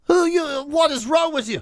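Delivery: high, hammed-up indignation; mock outrage. Host mocks his co-host for never tasting Cacavou, high outrage. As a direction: squeal it like a scandalised wine snob.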